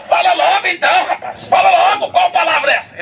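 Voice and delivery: high, pushy, hurry him up; impatient demand. Acted. Atheist pressing the brother to say it, high impatient voice. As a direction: demand it, losing patience.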